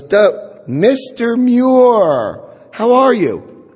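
the sergeant drags out a fake welcome; mock-friendly sergeant.

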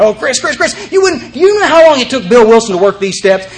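high, eager, tumbling over his words; excited. Retold or imitated. Newly sober man excitedly calling his sponsor. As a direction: gush like a kid with news.

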